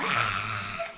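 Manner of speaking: sustained mock screech; long screamed "ah" in imitation; imitated child's scream. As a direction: mimic a hurt child screaming. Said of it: "ela não parava de gritar".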